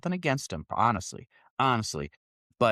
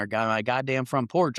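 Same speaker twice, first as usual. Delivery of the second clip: usual speaking voice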